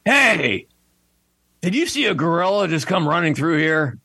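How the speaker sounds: high, urgent mock call; lion calling out. Lion asks disguised gorilla about a runaway, urgent calling out.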